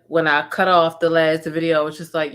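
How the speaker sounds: usual speaking voice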